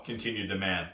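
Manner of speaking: plain reading voice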